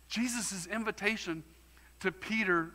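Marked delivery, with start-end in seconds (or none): none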